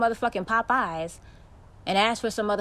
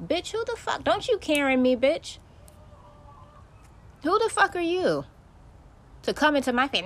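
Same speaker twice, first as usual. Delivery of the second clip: outraged; 8 semitones higher than usual. Real, not acted. Outraged rant at the woman.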